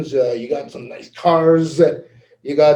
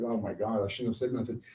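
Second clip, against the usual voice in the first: hushed mock regret; 8 semitones lower, 15 dB quieter than usual. Retold regret after reporting mistake.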